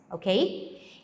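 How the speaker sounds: ordinary talk, nothing marked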